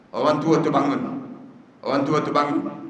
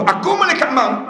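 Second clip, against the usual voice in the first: higher, louder proclamation of death; dramatic declaration; 8 semitones higher, 4 dB louder than usual. Acted. Preacher repeats the angel's words higher and louder. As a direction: announce it to startle the listeners. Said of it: "Bila sebut".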